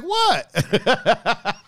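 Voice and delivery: high, laughing, playing dumb; a giggly, innocent "What?". [name] playing dumb to his friends with a laughing "What?". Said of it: "He's laughing about".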